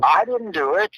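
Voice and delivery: very high mock-innocent child; little kid's voice. Prankster denying it to his friend, mock little-kid voice. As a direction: put on a squeaky kid protesting innocence. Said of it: "He could do a little kid's voice".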